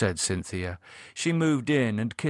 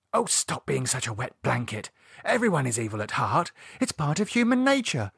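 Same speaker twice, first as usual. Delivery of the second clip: lightly voiced impatient woman, often higher; scornful Lydia; 7 semitones higher than usual. Lydia defending evil to her brother, scornful. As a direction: lecture briskly with rising pitch.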